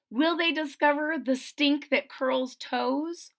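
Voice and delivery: put-on lively read-aloud rhythm for kids; storybook narration. A narrator's reading. Reader telling young listeners about Ada, lively storybook reading. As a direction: tell the rhyme to the children. Reads picture book aloud.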